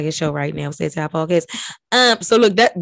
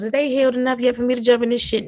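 quick higher question voice; higher asking voice; 4 semitones higher, faster than usual. Pretend. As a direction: ask it brightly and quickly.

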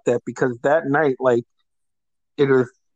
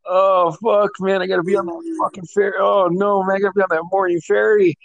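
higher, fast panicked whining; hungover panic; 5 semitones higher than usual. Retold or imitated. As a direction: fret about missing the ferry, quickly.

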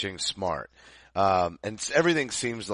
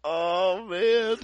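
giggling; 7 semitones higher than usual. Real, not acted. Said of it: "This turned into an outtake".

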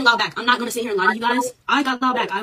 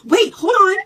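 high, loud sudden halt; startled; 10 semitones higher, 10 dB louder, much slower than usual. Retold or imitated. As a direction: jump up in pitch and volume, caught off guard. Professor startled by arrest request.

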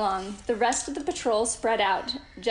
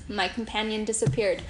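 ordinary delivery

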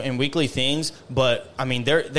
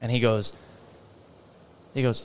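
ordinary talk, nothing marked

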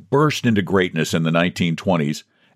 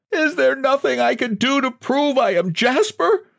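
high, pleading wail. A narrator's reading. John begging the president, wailing high. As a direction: plead through tears. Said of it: "He wailed".

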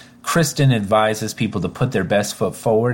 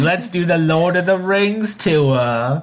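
slow, higher hammed-up Kiwi accent; mock New Zealand accent; 6 semitones higher, slower than usual. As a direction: drawl it out in a bad Kiwi accent.

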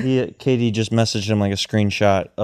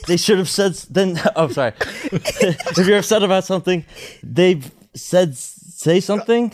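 higher, laughing read of a tweet; reading as Rapaport; 8 semitones higher than usual. Acted. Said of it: "play the role of Michael Rapaport".

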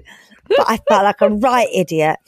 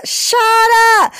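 Peggy Mitchell; 5 dB louder than usual. Retold or imitated. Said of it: "best Peggy Mitchell".

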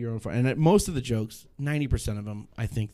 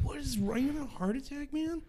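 mock alarm; 5 dB quieter than usual. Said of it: "I'm like".

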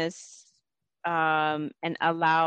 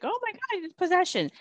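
high-pitched mock alarm, voice shooting up; mock freaking out; 12 semitones higher than usual. Host voicing people alarmed by channeling, mock freak-out. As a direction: imitate a panicked worrier in a much higher voice. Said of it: "freaking out".

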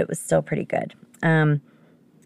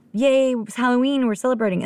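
higher-pitched cheerful yay; a light retold burst of celebration; celebrating; 8 semitones higher than usual. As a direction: cheer it in a lifted voice. Retells excited holiday mood.

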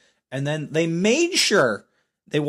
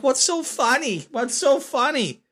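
puzzled woman; 10 semitones higher, slower than usual. Retold or imitated. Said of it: "And she's like".

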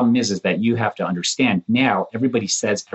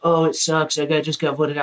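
high, whiny mock complaint; 8 semitones higher than usual. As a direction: whine it high. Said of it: "they're like".

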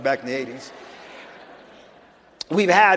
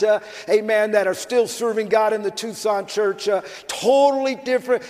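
raised, insistent preacher's voice; forceful preaching; 6 semitones higher than usual. Real, not acted. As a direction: press the testimony on the congregation with conviction. Preaches testimony at full force.

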